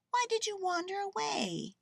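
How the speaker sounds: put-on high, questioning girl voice; Lisa. A narrator's reading. Girl asks her bear why, mildly chiding. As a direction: ask in a high, plaintive pitch.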